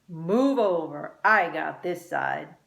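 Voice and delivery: slow, firm demand; bossy. A narrator's reading. Pablo bosses Luke in the car. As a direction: claim the seat. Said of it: "Pablo told Luke".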